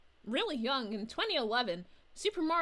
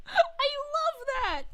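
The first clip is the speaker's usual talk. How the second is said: squealing through a laugh, much higher; 13 semitones higher, slower than usual. Real, not acted. Streamer cracking up at a viewer's joke, laughing. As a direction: gush it while still cracking up.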